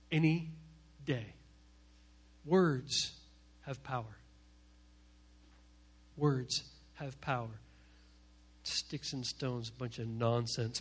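low, soft, slow and weighty; hushed emphasis. Real, not acted. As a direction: let each word sink in quietly. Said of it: "solemn preaching point".

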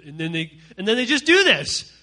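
usual speaking voice

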